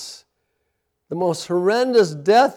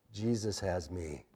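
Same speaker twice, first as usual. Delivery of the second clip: usual speaking voice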